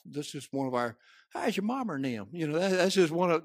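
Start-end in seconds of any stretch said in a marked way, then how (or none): 1.3-2.3 soft, higher, folksy hello; casual Southern greeting. Shown as a demonstration. Showing how Southerners greet each other, casual greeting. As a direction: ask after the family in a friendly way. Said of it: "that's just the South".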